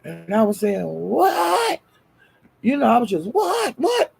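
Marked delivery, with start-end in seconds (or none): 0.8-1.8 very high squeal of disbelief; hammed-up astonishment, held out; shocked what. Acting out own shocked reaction. 3.3-4.1 repeated very high yelps; hammed-up squeals of disbelief; shocked what. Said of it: "I was just like that the whole time".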